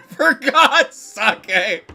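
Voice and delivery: high, slow words through laughter; laughing. Real, not acted. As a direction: laugh it out over the pun. Laughing at the pun.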